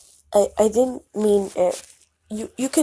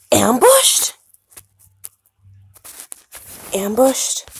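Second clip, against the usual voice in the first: louder, rising "ambushed?"; alarmed question; 2 semitones higher, 7 dB louder, slower than usual. Acted. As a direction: demand in alarm. Alarmed repetition.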